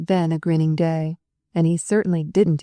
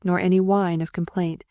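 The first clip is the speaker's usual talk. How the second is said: usual speaking voice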